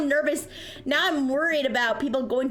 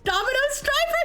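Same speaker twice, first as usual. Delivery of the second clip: mock incredulity; 13 semitones higher than usual. Acts out own incredulous question.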